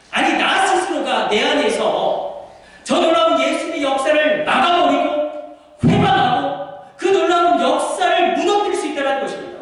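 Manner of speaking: high, rising preaching; preaching at full force. Acted. Preacher warning the congregation, building fervor. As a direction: build toward the climax.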